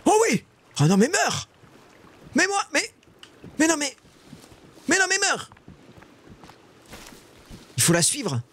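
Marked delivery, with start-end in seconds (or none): 0.0-5.5 very high, exasperated squeals; frantic squealing. Real, not acted. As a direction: squeal in disbelief at prey that won't die. Frantic as prey will not die.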